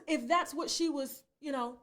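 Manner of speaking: ordinary talk, nothing marked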